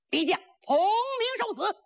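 high, ringing storyteller's challenge; battle challenge. A narrator's reading. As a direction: call the enemy out to die. Вызов вражеского воина перед боем.

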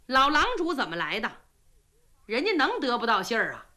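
ordinary delivery

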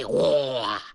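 plain reading voice

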